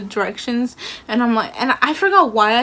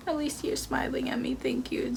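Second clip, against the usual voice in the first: soft unvoiced whisper of gratitude; whispered thanks; 3 semitones higher, 10 dB quieter than usual. Thanking the old lady at the stand, whispered.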